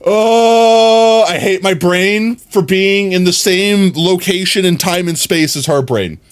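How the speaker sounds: high pained groan; drawn-out cringing complaint; exasperated groan. Real, not acted.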